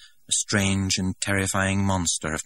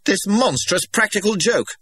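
much higher and louder furious shout; 7 dB louder than usual. A narrator's reading. Director shouting at Linda over the laughter, furious. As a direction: roar your outrage. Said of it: "the director shouted".

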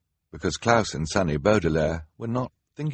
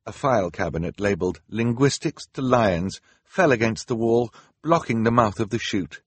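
plain reading voice

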